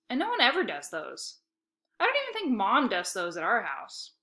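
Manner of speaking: ordinary delivery, nothing marked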